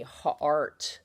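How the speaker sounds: "Heart" with a long drawn "ah"; modelled word. Shown as a demonstration. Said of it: "sounds like an A R to me".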